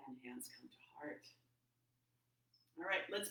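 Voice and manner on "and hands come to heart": soft breathy whisper. Real, not acted. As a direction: whisper the cue. Whispered cue in yoga class.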